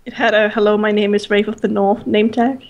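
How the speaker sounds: chirpy name tag voice. Pretend. Player reads joke name tag.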